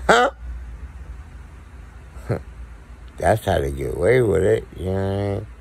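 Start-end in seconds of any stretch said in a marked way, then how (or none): 0.4-3.1 quiet breathy laugh. Real, not acted. Laughs after the bit.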